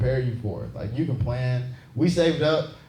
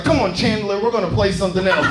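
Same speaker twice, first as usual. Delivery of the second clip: hint of a huffy mother, louder and higher; lightly voiced mom; 5 semitones higher, 6 dB louder than usual. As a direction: hurry the kid away, raised voice. Plays mother of crying child.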